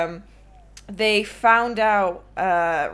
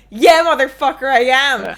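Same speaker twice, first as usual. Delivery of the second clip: loud, triumphant retort, higher; defiant shout; 4 semitones higher, 10 dB louder than usual. Retold or imitated. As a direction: throw it back at him loudly. Triumphant retort to former friend.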